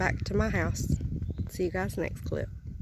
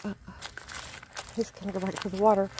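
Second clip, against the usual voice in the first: ordinary delivery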